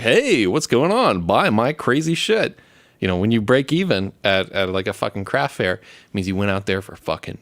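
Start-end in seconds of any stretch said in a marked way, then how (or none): 0.0-2.6 slow, higher, hammed-up salesperson patter; mock retail pitch. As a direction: play the eager booth seller, drawn out. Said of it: "to be a fucking retail, like".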